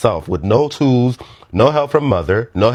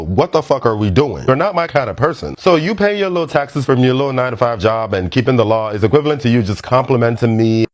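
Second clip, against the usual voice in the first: usual speaking voice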